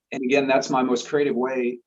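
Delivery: ordinary talk, nothing marked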